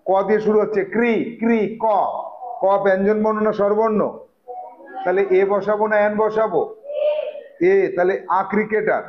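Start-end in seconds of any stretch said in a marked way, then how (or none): none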